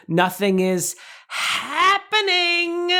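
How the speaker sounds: high, slow, held H in "happening"; high cartoon bird voice, holding the H; Scuttle impression. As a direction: stretch "happening" like a cartoon seagull. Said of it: "He holds the H".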